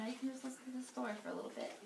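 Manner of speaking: rushed, soft aside; fast aside. Real, not acted. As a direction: hurry through the aside. Quick aside to child.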